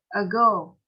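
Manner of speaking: "Ago" with GO punched; stress on second syllable. Shown as a demonstration. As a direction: read out "Ago", leaning hard on GO. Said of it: "Two, yes".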